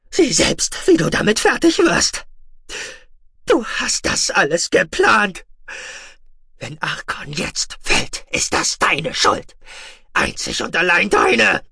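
high, loud unhinged ranting; raving madman. Acted. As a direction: rant like a man gone mad. Deranged commander accuses her.

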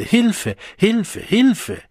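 high, loud repeated cry. A narrator's reading. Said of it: "immer lauter". (immer lauter geschrien)

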